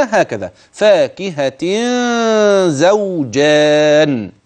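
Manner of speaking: زوجان drawn out slowly with a long held ghunna on the hidden nun; ghunna demo. Shown as a demonstration. Teacher showing students how the ghunna sounds, slow and drawn out. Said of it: "كيف تكون الغنة؟".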